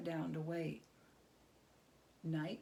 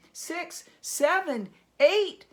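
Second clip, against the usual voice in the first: each number higher and louder; building count; 9 semitones higher, 13 dB louder, slower than usual. A narrator's reading. Narrator counting candles with mounting dread, building. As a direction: climb with every candle.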